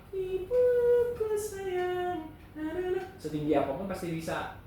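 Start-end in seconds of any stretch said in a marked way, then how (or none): 0.1-3.0 head voice. Shown as a demonstration. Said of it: "suara kepala".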